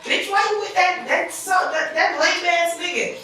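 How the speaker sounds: high, scoffing friend voice, pitched well up; mocking girlfriend. Friend scoffing at her boyfriend, high mocking voice. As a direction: sneer at her choice of man like a judgy friend. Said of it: "Her friends will be like".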